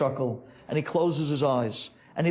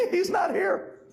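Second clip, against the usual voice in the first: high voice after a giggle; acted helpless giggle; nervous laughing reply; slower than usual. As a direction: squeak it out through a nervous laugh. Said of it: "You think that's funny?".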